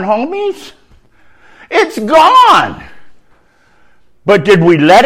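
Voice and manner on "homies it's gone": triumphant. Acted. Triumphant repeat.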